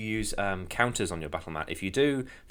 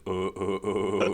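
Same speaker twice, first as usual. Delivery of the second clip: repeated throat-placed "uh" grunts; Sans's starting noise. Shown as a demonstration. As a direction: find the voice through grunted noises. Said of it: "for Sans, maybe I would start off going".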